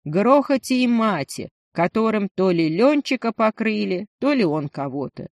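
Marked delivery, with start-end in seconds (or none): none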